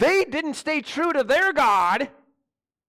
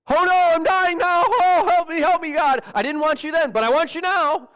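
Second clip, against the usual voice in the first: high, loud whiny wail for help; mock desperate plea, pitched up and loud; mock crying like a baby; 7 semitones higher, 5 dB louder than usual. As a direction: ham up a panicked baby crying to God. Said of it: "crying like a baby".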